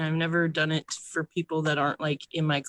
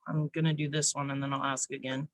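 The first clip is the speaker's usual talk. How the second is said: ordinary talk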